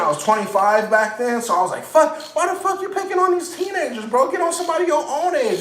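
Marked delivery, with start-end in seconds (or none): 1.8-5.6 mock outraged call-out. Confronting bullies at work.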